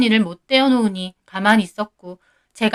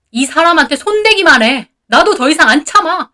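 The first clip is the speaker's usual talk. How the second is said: higher, louder threatening roar; booming shout; 6 semitones higher, 6 dB louder than usual. A narrator's reading. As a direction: warn the brother off with full force. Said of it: "쩌렁쩌렁 소리를 지르더라고요". (아내를 지키려 쩌렁쩌렁 외치는 남편)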